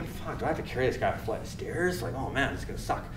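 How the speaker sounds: soft aside, acting out a private complaint; inner thought. Medic facing a flight of stairs, dreading under his breath. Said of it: "So I'm, like, thinking".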